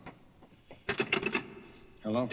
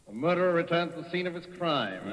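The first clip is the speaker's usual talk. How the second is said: taunting; 6 semitones higher, 5 dB louder than usual. Acted. Franklin taunts the intruder.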